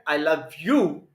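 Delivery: "I love you" said slowly, weight on "you"; slow down and land on "you"; stress on YOU, slow. Shown as a demonstration. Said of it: "I'm loving only you".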